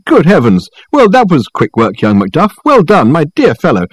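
high, effusive greeting; exclaiming. A narrator's reading. Reg greeting young Macduff at the door, exclaiming. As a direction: gush with surprised welcome. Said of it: "he exclaimed".

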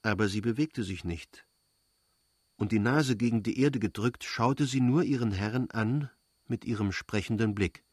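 ordinary delivery

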